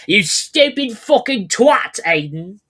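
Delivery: high, loud British scolding; indignant put-on British voice; mock British accent. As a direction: berate in an exaggerated British accent. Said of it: "I don't know what your actual accent is".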